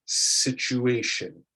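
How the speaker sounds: situation said very slowly, with a clear ch; slow model word. Shown as a demonstration. Teacher models situation for students to repeat. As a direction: spell out situation slowly, stressing the ch. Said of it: "repeat after me".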